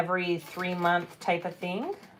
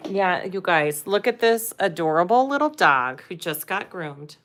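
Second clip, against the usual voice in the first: ordinary delivery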